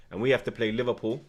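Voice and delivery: ordinary talk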